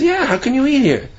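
high incredulous question. Retold or imitated. Man questions friend in treif restaurant.

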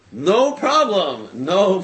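slow, loud, drawn-out reassurance; retold easy yes, stretched out big; expansive agreement. Wealthy man accepts her one condition, expansively sure.

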